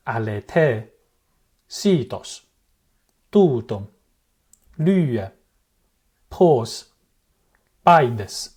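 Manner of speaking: each word with pitch falling across the long vowel; circumflex words. Shown as a demonstration. Teacher reading examples, circumflex pitch falling. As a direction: glide the pitch down inside each accented vowel.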